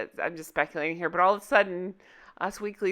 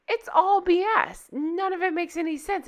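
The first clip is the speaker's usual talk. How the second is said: exasperated; 9 semitones higher than usual. Real, not acted. Exasperated rant about lies.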